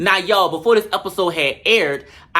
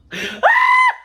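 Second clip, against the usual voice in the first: high, loud screaming laugh; shrieking laugh; 4 dB louder than usual. Real, not acted. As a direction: throw your head back and shriek with laughter. Cackling at the burn.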